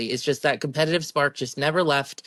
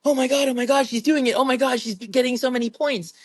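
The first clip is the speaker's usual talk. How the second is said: much higher, excited cheering; coach losing it; 9 semitones higher than usual. Retold or imitated. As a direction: imitate a coach freaking out with joy. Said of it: "he's losing his marbles".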